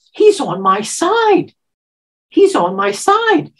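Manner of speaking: high, slow and loud with relief; high slow inner joy. Retold or imitated. Woman recalling her thoughts as the cop arrives, slow and elated. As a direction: savor it like a secret triumph. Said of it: "But I thought".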